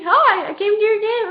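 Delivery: high, loud, bubbly announcement; eager greeting. Retold or imitated. Acted boy's eager greeting.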